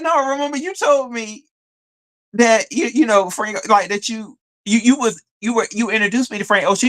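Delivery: high-pitched insistent reminder; insisting disbelief. Retold or imitated. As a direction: press him, voice way up in disbelief. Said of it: "I was like".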